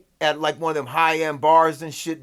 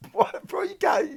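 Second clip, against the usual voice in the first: much higher squeak of disbelief; incredulous disbelief. Retold or imitated. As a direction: react like you can't believe what you heard. Disbelief at friend's shocking story.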